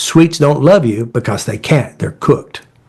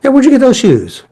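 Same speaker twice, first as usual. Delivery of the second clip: much higher and louder, bright and insistent; louder repeated question; 12 semitones higher, 4 dB louder than usual. Retold or imitated. Repeating the shoe question after the client says huh, high and loud. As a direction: jolt her out of it with the shoes question.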